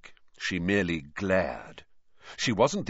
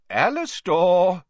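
louder, slow warning, spoken as a firm caution; a slow, louder name that reins him in; 10 semitones higher, 7 dB louder, slower than usual. A narrator's reading. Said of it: "said Dumbledore warningly".

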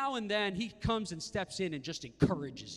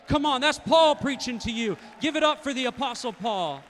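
loud, high call for applause; rallying shout; 7 semitones higher, 9 dB louder than usual. Real, not acted. Preacher calling for applause for Paul, loud shout. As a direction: shout to get them clapping.

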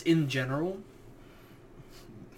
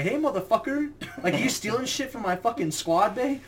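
imitating drill instructor; 7 semitones higher than usual. Drill instructor confronting a thief.

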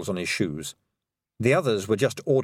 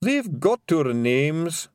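slow, high despairing Scots; mild mock sob; sobbing pixie; 6 semitones higher, much slower than usual. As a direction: wail in defeat. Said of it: "sobbed Daft Woolly".

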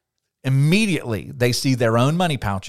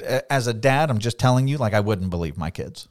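ordinary talk, nothing marked

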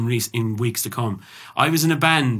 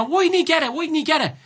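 high-pitched mock shouting; retold shouting; 13 semitones higher than usual. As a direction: yell it in a shrill retold rant. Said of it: "I started shouting and roaring".

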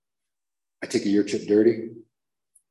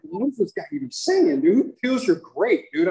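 much higher, louder puzzled voice; baffled onlookers; 11 semitones higher, 5 dB louder than usual. Retold or imitated. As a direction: act out confused bystanders. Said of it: "Everyone's like".

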